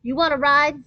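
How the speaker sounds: high, loud call from a car; girl calling from the car. A narrator's reading. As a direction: shout the offer from the car. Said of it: "a voice shrieked".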